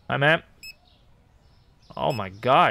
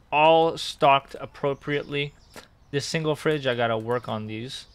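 ordinary delivery

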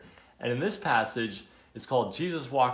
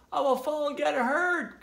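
high-pitched storybook wail; mildly panicked character voice; frightened cry; 3 dB louder than usual. A narrator's reading. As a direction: cry out your worry in a high voice. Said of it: "cried Tudley".

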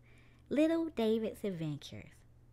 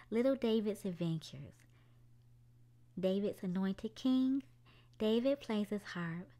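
plain reading voice

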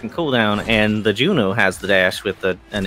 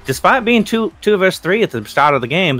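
higher, louder winner announcement; excited victory call; 6 semitones higher, 4 dB louder than usual. Real, not acted. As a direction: announce the upset win with hype. Caster announcing the comeback win.

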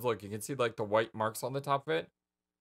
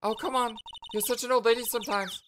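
put-on perky girl voice; 11 semitones higher than usual. Perky sidekick voice.

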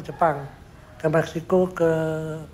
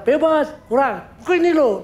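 mock shout; 8 semitones higher than usual. Sukarno showing the sculptor how to shout. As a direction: show him how the cry should sound. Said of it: "Coba kok berteriak gimana?".

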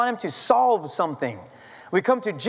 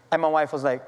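ordinary talk, nothing marked

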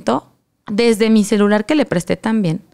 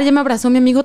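normal talking voice

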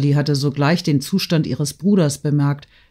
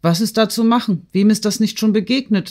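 higher-pitched careless shrug of a question; brazen, flippant; 6 semitones higher than usual. A narrator's reading. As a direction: brush it off cheekily. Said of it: "in dreistem, leichtfertigem Ton".

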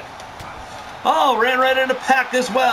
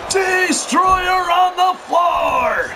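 slow, drawn-out roaring call; excited shout; 6 semitones higher, much slower than usual. Real, not acted. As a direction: belt it out to the crowd. Live wrestling call, big move.

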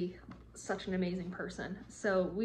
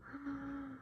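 high, soft gasp; quiet high gasp of wonder; awed gasp; 6 semitones higher, 9 dB quieter than usual. Real, not acted. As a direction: gasp softly in amazement. Awed at the gift.

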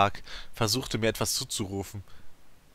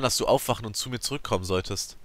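ordinary delivery, nothing marked

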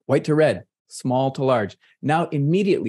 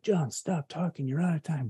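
higher, softer mock interruption; imagined heckler; 5 semitones higher, 6 dB quieter than usual. Lecturer imagines audience cutting him off, mock heckle. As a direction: play an audience member cutting him off, lightly.

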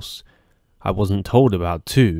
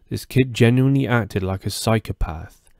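ordinary delivery

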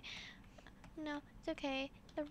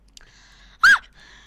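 very high, loud gasp of alarm; loud shocked gasp; 20 dB louder than usual. Real, not acted. Gamer nearly caught moving on red light, loud gasp.

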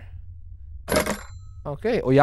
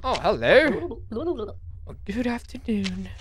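lady visitor voice; 8 semitones higher than usual. Acted. Voicing visitor at the door.

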